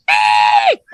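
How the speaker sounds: high-pitched imitated shriek; short, piercing mimicked scream; baby-like scream. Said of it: "I hear a baby screaming".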